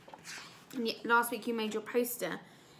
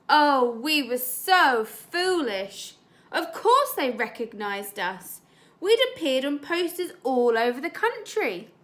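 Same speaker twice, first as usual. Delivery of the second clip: story reading; 2 semitones higher, 7 dB louder than usual. Acted. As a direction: read it with mock regret. Teacher reads story aloud.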